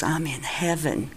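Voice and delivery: low, soft, awed reply; quietly marvel, as if seeing heaven; retold hushed awe; hushed wonder. Retold vision, big smile on face.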